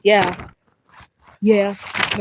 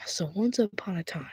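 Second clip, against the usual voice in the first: storytelling whisper; 11 dB quieter than usual. Acted. Starts the bedtime story.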